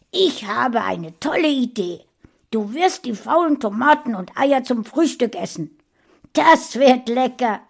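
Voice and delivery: high, loud, gleefully cruel mother; gloating mother. A narrator's reading. Mother gloating as she orders rotten breakfast, gleeful and loud. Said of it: "lachte Saschas Mutter".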